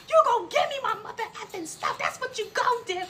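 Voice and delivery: thin high screech, no real volume; squealed mock tantrum; mock screaming. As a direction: imitate a guest yelling her demands. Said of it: "she's screaming and yelling and yelling".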